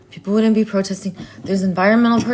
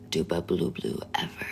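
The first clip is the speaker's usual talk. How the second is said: low odd voice; 12 dB quieter than usual. Acted. Nonsense chant in odd voice.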